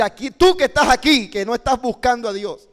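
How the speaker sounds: loud, high direct challenge; single out the listener from the pulpit, forcefully; confrontational preaching. Shown as a demonstration. Teacher shows preaching straight at the listener, loud and confronting. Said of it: "No es lo mismo decir".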